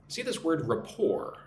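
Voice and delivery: "rapport" with no T, ending on an open "por"; silent T. Shown as a demonstration. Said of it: "You don't say the T".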